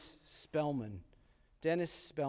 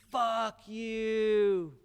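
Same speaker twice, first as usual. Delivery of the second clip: high, stretched taunt after someone leaving; defiant yell; 7 semitones higher than usual. Retold or imitated. Boy yelling at rival riding off into distance, high taunt. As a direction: call it out after him into the distance.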